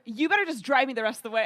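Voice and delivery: acted indignant command; retold angry demand. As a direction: order the driver firmly, loudly. Retold angry order to driver.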